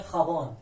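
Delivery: خابان starting with throaty kh. Shown as a demonstration. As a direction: say خابان with the j pronounced kh. («خابان» با صدای خ)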